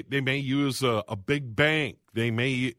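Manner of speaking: ordinary delivery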